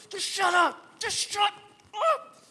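high, loud fed-up yell; angry family shouting. Acted. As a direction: snap "shut up" at top volume, hammed-up car fight. Acted fight on way to church.

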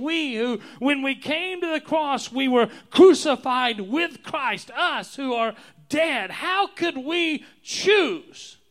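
fervent apostle crying out, then dropping on the last words; Paul's impassioned plea. Retold or imitated. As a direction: voice Paul in full fervour, near shouting. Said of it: "It's as if Paul is saying".

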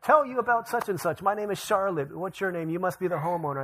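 high, bright door-to-door pitch; chipper saleswoman. Retold or imitated. Door-to-door saleswoman greeting the homeowner, high and chipper. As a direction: put on a perky sales smile. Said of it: "she's like, all chipper".

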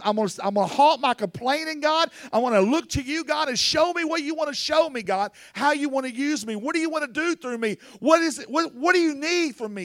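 high, pleading prayer to God; fervent preacher's prayer, pitched high; earnest prayer. Acted. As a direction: pour it out to God, begging to be used. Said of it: "But ask God, say".

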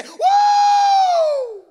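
very high, loud, sustained whoop; praise whoop. Shown as a demonstration. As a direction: let out a long ringing "woo" of praise. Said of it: "I just have to say".

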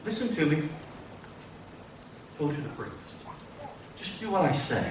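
low, hushed, patient order; calm command. Acted. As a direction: answer softly and low, pausing between sentences. Voices Jesus's quiet reply.

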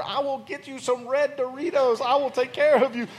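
consoling dad. Retold or imitated. Father comforting daughter in story.

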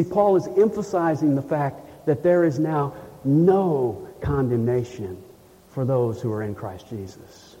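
ordinary talk, nothing marked

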